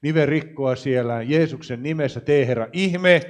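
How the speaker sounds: full-force healing prayer. Acted. Preacher calls on God to heal the congregation, loud fervent preaching. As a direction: cry out for healing over the crowd, pressing each phrase.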